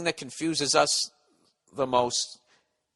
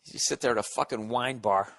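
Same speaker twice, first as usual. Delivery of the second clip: ordinary talk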